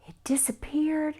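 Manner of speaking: breathy, hushed repeat; suspense whisper. A narrator's reading. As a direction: whisper it to build dread.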